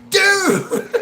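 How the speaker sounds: high loud exclaim. Real, not acted. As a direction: blurt it out. Punchline exclaimed.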